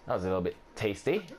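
ordinary talk, nothing marked

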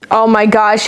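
ordinary talk, nothing marked

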